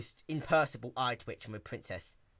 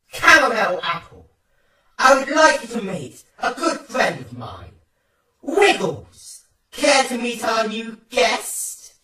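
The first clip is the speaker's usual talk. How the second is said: loud, higher, mock-polite menace; sinister introduction; 10 semitones higher, 13 dB louder, slower than usual. Twilight presenting Wiggles to Caramel, sinister politeness. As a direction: introduce the monster sweetly and threateningly.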